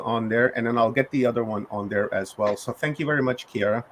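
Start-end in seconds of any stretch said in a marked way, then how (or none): none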